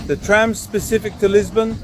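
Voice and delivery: talking while chewing; words round a mouthful; mouth full. Real, not acted. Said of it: "Now I'm talking with my mouth full".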